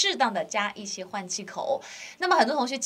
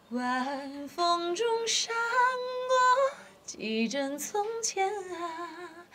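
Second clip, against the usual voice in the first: high, soft light singing; light falsetto mix; soft head voice; 6 semitones higher, much slower than usual. Shown as a demonstration. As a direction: float it up in head voice. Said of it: "用头声多一点的弱混技巧去唱".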